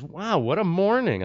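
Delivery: retelling the wife's stunned reaction; wife's amazed wow. Retold or imitated. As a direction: pitch it up in wide-eyed disbelief. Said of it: "she goes".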